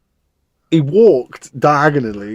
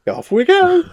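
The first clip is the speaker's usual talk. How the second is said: much higher, silly send-off; high mock voice; 4 dB louder than usual. Imitating the stoned stranger leaving, high mock voice. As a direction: pipe up high, mimicking him drifting away. Mimicking the man high on pipe.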